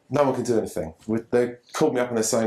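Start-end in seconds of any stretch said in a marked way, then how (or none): none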